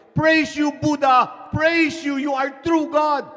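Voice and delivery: high, loud jubilant cry; shouting praise. Acted. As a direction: shout thanks to your god for surviving. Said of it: "was alive and shouting".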